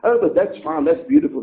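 ordinary talk

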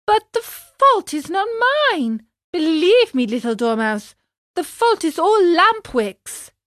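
high-pitched, pleading puppet; whining self-defence; protesting puppet. A narrator's reading. Puppet tearfully protests his innocence.

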